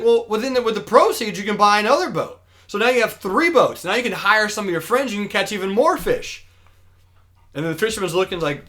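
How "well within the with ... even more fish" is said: higher-pitched, keen salesman patter; acted eager pitch. Businessman selling fisherman a growth plan, acted eager pitch. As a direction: sell the idea with lightly acted enthusiasm.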